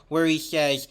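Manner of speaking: ordinary delivery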